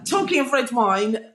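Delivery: ordinary delivery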